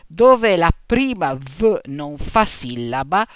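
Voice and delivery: normal talking voice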